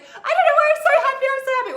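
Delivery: a hammed-up giddy burst of joy; giddy happy. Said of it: "we're like".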